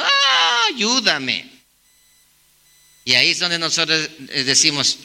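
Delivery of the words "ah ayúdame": a mock wail that breaks high, then a small plea; crying plea. Grown child returning to parents in tears, mock wailing plea. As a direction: imitate a child crying for help. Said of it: "llegan llorando y diciendo".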